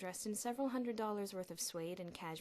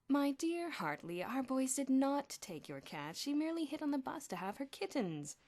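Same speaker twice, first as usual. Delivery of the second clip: smug and condescending; 7 semitones higher, 6 dB louder than usual. A narrator's reading. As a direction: savour every word of it. Headmaster gloating to rival.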